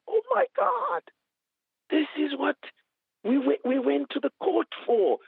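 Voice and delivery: high, tearful retelling of her outburst; imitating her crying. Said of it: "She's crying on the other side".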